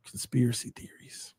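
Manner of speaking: hushed, unvoiced aside; whispered. Real, not acted. Commentator floats his jinx theory to viewers, whispered. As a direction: whisper it like a secret. Jokes about name jinxing the runner.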